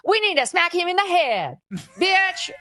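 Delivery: hammed-up street-tough impression with a laugh. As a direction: sneer it out like a wannabe thug, ending in a laugh. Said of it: "why are you talking like that?".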